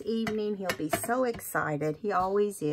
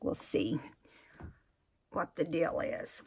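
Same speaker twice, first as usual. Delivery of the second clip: whisper; 6 dB quieter than usual. Real, not acted. Quiet aside while sewing.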